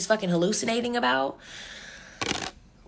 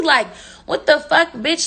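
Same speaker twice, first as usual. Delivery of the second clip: higher, louder disbelief; exasperated outburst; 5 semitones higher, 3 dB louder than usual. Retold or imitated. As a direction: retell it with incredulous exasperation, pitched up. Retold exasperation about crazy friend.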